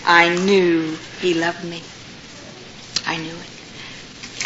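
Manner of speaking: overcome, nearly too moved to speak; choked hush. Real, not acted. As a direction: let the words barely come out. Emotional pause, overwhelmed.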